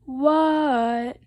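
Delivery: loud, drawn-out yell back; long, stretched storybook shout; shouted reply. Acted. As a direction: holler it back across the house. Shouting back to yelling Dad.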